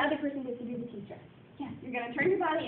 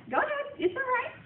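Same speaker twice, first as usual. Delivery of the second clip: usual speaking voice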